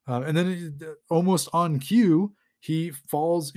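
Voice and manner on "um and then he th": usual speaking voice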